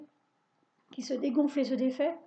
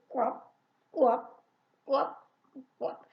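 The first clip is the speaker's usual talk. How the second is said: short hiccuping frog croaks; much slower than usual. A narrator's reading. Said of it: "en hoquetant comme elle peut". (coassements de grenouille hoquetants)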